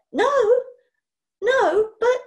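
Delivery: loud, high hurried denial; anxious protest. Acted. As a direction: protest in a panic. Boy protests quickly.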